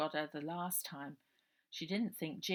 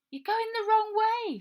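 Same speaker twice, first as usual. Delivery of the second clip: put-on much higher, louder girl's call; 13 dB louder than usual. Ida calling after Robert in the alley, loud high call. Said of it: "she called".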